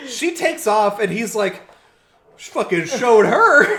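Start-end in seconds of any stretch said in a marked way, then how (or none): 2.4-3.7 high, loud crowing through laughter; mock triumphant boast. Boyfriend boasts after girlfriend leaves, mock triumphant. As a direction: imitate a deluded victor.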